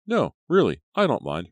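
high opening, lightly joking; joking insistence. A narrator's reading. Ricky jokingly offers to go home, playful insistence. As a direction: insist in jest. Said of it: "Ricky joked".

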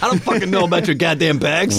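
high, hammed-up tipsy outburst; put-on indignant complaint; mock belligerent. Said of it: "Mince pie martini Jim's a little belligerent".

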